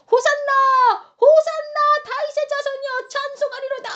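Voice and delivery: high, ringing cry of praise; crowd acclamation. Acted. Crowds hail Jesus riding into Jerusalem, high shouted acclamation. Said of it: "라고 외치는 모습이 나와요".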